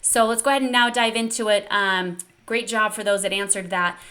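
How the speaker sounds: usual speaking voice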